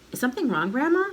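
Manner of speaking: innocent, courteous inquiry; polite asking. A narrator's reading. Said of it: "George asked her politely".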